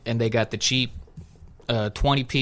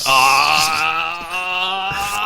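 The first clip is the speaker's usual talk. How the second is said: drawn-out, higher held "aah"; long dumbfounded "aah"; 5 semitones higher than usual. Retold or imitated. Drive-thru worker freaking out at the speaker, mock stunned drone. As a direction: hold one long blank "aah" in mock panic.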